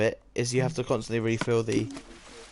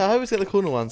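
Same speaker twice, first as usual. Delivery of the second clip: exasperated; 8 semitones higher, 6 dB louder than usual. Real, not acted. Complaining about the game.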